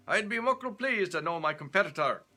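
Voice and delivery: put-on loud, high, jolly Scottish accent. Stewart, jolly, to Leonardo, in broad Scottish accent. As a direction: laugh it out in broad Scots. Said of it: "Scottish accent".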